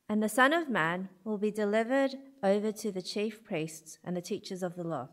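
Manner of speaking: ordinary delivery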